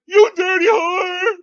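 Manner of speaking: high, loud, shrieking jealous outburst; outraged shrieking rant. Retold or imitated. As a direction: shriek it like a jilted lover, crying out. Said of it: "And Sasquatch went".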